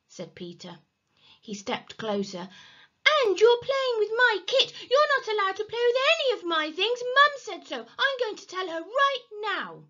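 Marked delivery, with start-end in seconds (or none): 3.1-9.8 high, loud, squealing indignation; outraged tattling. A narrator's reading. Peter threatening to tell Mum, outraged. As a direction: build a tattletale's case.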